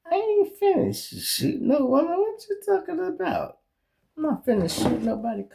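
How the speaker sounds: high, put-on mock tough voice; lightly voiced hammed-up retort; mock tough reply. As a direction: ham up his hot-headed answer. Quoting his own reply.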